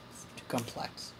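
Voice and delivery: whisper. Real, not acted. Muttered aside on voting form.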